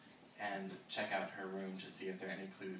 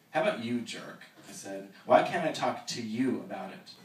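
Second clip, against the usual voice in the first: lashing back; 2 semitones higher, 11 dB louder than usual. Acted. Evan snaps back at Jack, angry and hurt. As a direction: throw the question back at him.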